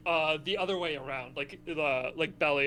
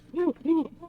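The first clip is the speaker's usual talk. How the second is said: high, loud whooping howl; 12 semitones higher, 5 dB louder than usual. Pretend. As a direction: howl like a cheering fan. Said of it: "Elmer's howl is literally just him going".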